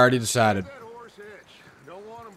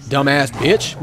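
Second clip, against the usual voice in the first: ordinary delivery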